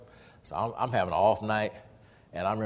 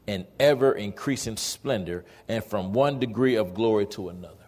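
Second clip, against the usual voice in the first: scripture reading; 3 semitones higher, 6 dB louder than usual. Real, not acted. Preacher reading the verse to the congregation, emphatic proclamation. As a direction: proclaim the verse in measured, forceful phrases.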